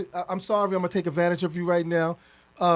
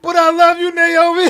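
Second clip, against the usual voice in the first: crying; 9 semitones higher, 9 dB louder than usual. Real, not acted. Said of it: "I'm crying too".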